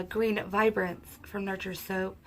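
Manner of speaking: usual speaking voice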